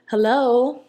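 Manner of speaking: loud, pointed demand for an answer; impatient hello. Retold or imitated. Waiting on his Snapchat reply, loud and impatient. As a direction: call him out for stalling.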